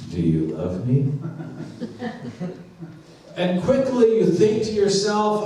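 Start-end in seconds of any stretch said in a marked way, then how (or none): 0.1-1.1 low, lightly acted questioning voice; voice in bed. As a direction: ask it low, rising at the end. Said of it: "you hear this voice".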